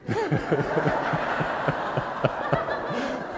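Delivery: airy wheezing laugh at the joke; breathy silent laugh. Real, not acted. Preacher laughing at his own joke, silent and airy. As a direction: laugh almost without voice.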